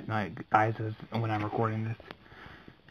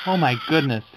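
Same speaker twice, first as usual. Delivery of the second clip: loud startled outburst; startled shout; 2 semitones higher, 6 dB louder, faster than usual. Real, not acted. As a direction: yell out at the scare. Reacting to scary cut.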